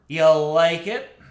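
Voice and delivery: slow, loud heroic voice; corny superhero voice. Pretend. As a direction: ham it up like a comic book hero. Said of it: "my corny superhero voice".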